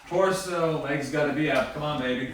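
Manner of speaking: high, loud cheering on; excited coaching. Real, not acted. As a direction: hype up a friend in an ice bath. Coaching friend through ice bath.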